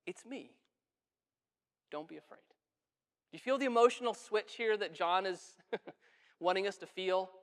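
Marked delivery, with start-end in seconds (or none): 0.1-2.4 softly, much quieter, calming frightened friends; gentle reassurance. Acted. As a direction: hush it like soothing someone terrified. Jesus calming terrified disciples.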